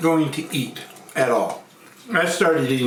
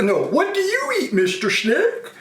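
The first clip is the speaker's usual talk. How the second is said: very high, swooping frog question; high-pitched cartoon frog; frog character; 14 semitones higher, slower than usual. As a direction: ask the snake in a high, hammed-up frog voice. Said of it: "wide mouth frog".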